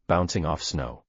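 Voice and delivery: ordinary delivery, nothing marked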